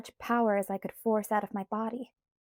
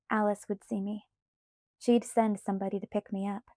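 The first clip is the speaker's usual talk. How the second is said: plain reading voice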